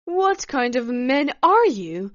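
loud, indignant outburst; high-flung, angry challenge; explosive anger. A narrator's reading. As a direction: explode at the men. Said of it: "Svetlana then exploded".

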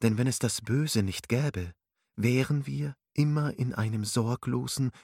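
usual speaking voice

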